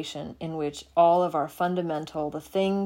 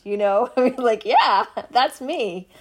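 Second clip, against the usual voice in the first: laughing, louder; 5 semitones higher, 8 dB louder than usual. Real, not acted. Laughing at shared memory.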